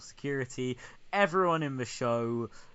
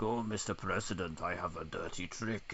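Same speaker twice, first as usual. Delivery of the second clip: low, softer Russian villain voice; mock Russian accent; 6 semitones lower, 7 dB quieter than usual. Russian taunting the president, mock Russian accent, low. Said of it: "The Russian is like".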